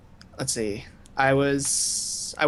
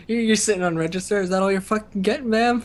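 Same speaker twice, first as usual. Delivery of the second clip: mock rude cashier; 6 semitones higher than usual. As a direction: pretend rude cashier snapping at a customer.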